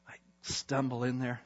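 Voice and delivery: ordinary talk, nothing marked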